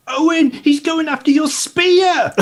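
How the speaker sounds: high, loud, drawn-out shout; shouted warning. Acted. Gully shouts to Owen about his spear, shouted warning. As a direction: yell a warning across to a companion. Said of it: "Gully's going to shout to Owen".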